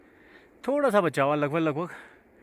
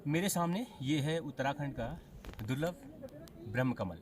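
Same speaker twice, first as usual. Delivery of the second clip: hushed; 4 semitones lower, 18 dB quieter than usual. Real, not acted. Presenting rare flower up close.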